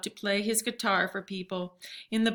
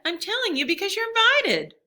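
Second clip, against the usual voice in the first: insistent; 12 semitones higher, 6 dB louder than usual. A narrator's reading. Girl insisting to distracted father.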